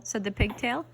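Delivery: usual speaking voice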